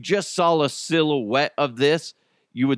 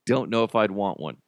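ordinary delivery

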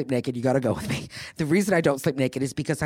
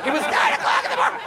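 very high, rushed, scandalized squeal; shrieking mock outrage; 3 dB louder, faster than usual. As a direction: shriek it fast in hammed-up disbelief. Repeats the outrage, escalated.

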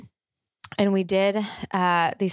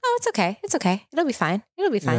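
breezy brushing off; 4 semitones higher than usual. Retold or imitated. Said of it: "or just to be like".

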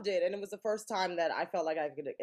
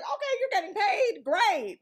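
excited mom; 13 semitones higher, 5 dB louder than usual. Retold or imitated. Mom quoted, high.

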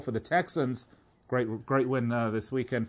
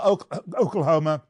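coughing through the word, choking up; 4 semitones higher, 6 dB louder than usual. Real, not acted. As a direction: cough mid-word and clear your throat. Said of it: "I'm choking to death here".

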